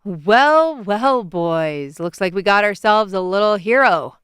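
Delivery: put-on loud, swaggering drunk sneer; drunken slur. A narrator's reading. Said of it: "one of them slurred".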